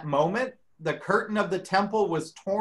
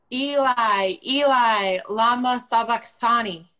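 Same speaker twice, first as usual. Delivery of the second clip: loud anguished cry, pitched higher; 5 semitones higher, 4 dB louder than usual. Acted. Jesus calls out to God from the cross, loud and high. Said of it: "cried with a loud voice".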